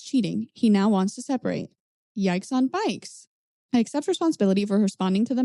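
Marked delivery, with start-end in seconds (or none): 2.2-3.3 drawn-out yikes. Acted. Drawn-out catchphrase reaction.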